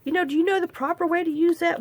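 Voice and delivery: high, prissy mock of everybody's advice; mocking know-it-all. Vlogger mocking people who lecture her about ink, high prissy voice. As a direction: mimic the condescending know-it-all, pitched high. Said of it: "everybody's like".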